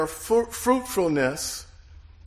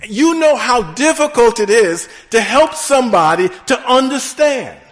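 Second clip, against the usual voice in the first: louder, rising preacher's build to the congregation; full-force preaching; 4 semitones higher, 6 dB louder than usual. Acted. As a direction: preach it out, climbing to the peak.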